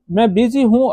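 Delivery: ordinary talk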